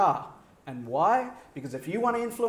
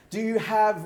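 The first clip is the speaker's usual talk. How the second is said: normal talking voice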